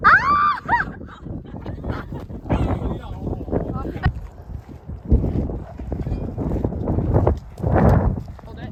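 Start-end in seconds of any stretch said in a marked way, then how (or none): 0.1-0.6 high, loud shriek; excited scream. Real, not acted. Excited scream during photo. 0.6-8.4 high unvoiced giggling; high laughter. Real, not acted. Laughing after scream.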